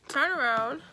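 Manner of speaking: usual speaking voice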